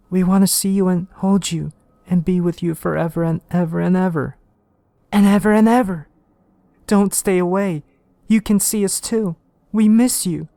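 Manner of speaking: high, loud eerie calling of the dead; ghostly family voice. Acted. Dead wife and child calling the detective, high and eerie. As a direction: lure him in a high ghostly voice.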